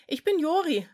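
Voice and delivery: put-on cheerful kid introducing himself; boy voice. A narrator's reading. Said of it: "antwortete er".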